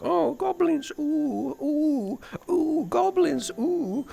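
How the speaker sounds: high mocking falsetto, drawn-out oohs; sing-song fake spooky wail, high and airy; mock oohing. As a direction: coo it in a silly high voice. Mock chorus of unbothered villagers.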